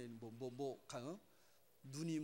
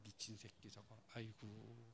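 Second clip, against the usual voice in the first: softer grumble under breath; muttered aside; 4 semitones lower, 10 dB quieter, slower than usual. Retold or imitated. Father muttering about his son under his breath. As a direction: mutter it to yourself.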